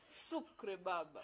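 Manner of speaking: ordinary talk, nothing marked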